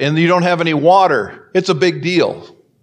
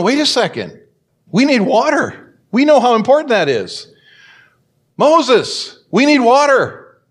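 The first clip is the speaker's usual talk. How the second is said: anxious crowd; 6 semitones higher than usual. Acted. Israelites complaining to Moses.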